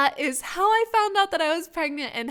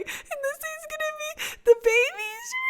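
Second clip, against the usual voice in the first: very high, squeaky imitation wail; mock ugly crying. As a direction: imitate blubbering through the words, pitched way up. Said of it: "I just started ugly crying".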